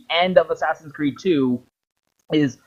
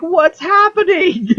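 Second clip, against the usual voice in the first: high, slow, squeaky bafflement; confused player; 3 dB louder than usual. Retold or imitated. Player watching the hologram, squeaking high and slow in confusion. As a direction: squeal it like a lost gamer. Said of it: "And you're like".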